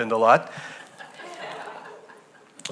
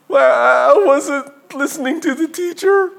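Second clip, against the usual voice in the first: put-on tearful boy; 10 semitones higher, 3 dB louder than usual. Crying boy explains.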